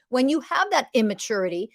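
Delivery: ordinary delivery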